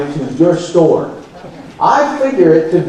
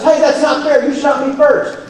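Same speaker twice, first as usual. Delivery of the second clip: high, hammed-up complaint of a sore loser; mock whiny loser; 8 semitones higher than usual. Speaker mocking a racer who lost the race. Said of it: "He jumps up and says".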